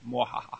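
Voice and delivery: whispered evil mwahaha laugh; quiet, playful villain cackle; mad scientist. Pretend. Villain laugh after plugging contest.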